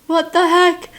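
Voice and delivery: loud, high burst of disbelief; shocked outburst. Real, not acted. Reacting to the aunt spanking a kid, a loud stunned outburst.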